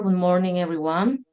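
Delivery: ordinary talk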